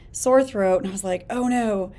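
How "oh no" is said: usual speaking voice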